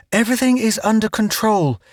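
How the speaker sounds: high, strained reassurance; forced calm. A narrator's reading. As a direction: insist all is well. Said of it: "Judy finished".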